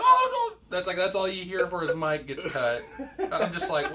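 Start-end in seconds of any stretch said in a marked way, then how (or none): 0.0-0.6 very high, loud yelp; mock panicked cry. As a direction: wail in comic alarm as you are caught. Friend's cry as mic cuts.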